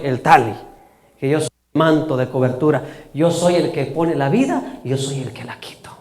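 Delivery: low, soft, measured voice; hushed authority. Acted. Jesus takes the parents aside, quiet and assured. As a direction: speak privately, fading to a whisper.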